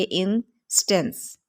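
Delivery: Instance slowly, in two beats, in-stance; split into syllables. Shown as a demonstration. Teacher shows how we say it, instance split as in, stance. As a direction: pause between the two halves of instance. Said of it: "हम इस तरह से कहेंगे".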